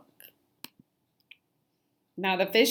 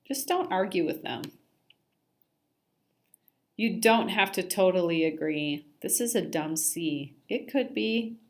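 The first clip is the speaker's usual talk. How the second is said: plain reading voice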